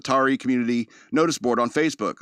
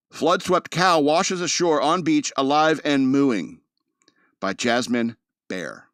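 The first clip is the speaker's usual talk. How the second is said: slow, loud newsreader headline; dramatic headline read; 2 semitones higher, 5 dB louder, slower than usual. Acted. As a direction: read it like a big news headline.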